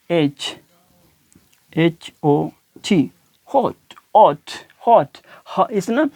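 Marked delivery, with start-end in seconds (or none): 3.5-5.1 हॉट with an almost silent h; barely any h. Shown as a demonstration. Teacher showing students: हॉट with a near-silent h. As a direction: breathe the h of हॉट very faintly. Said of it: "एच तो बिल्कुल ही ना होने के बराबर है".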